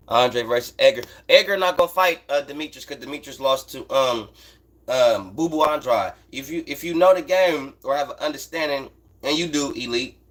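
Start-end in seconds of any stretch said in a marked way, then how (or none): none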